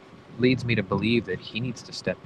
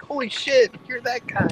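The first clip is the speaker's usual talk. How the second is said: much higher, louder excited exclamation; starstruck shout; 6 dB louder than usual. Retold or imitated. Fan suddenly recognizes the famous voice guy, high excited shout.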